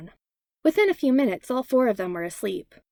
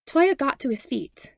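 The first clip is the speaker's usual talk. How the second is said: ordinary delivery, nothing marked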